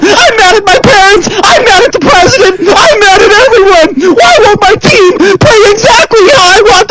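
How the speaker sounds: shrill high wailing fake sobs, shouted; whiny crying gamer. Channel's [name] mocks toxic player, high loud fake crying. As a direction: bawl like a toddler mad at his team. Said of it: "this is what they're gonna hear".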